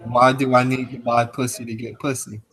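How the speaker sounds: ordinary talk, nothing marked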